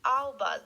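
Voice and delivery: album stressed on AL, falling end; a crisp album for the class to copy; model word album. Shown as a demonstration. Teacher models album for students to repeat. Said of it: "Let's repeat".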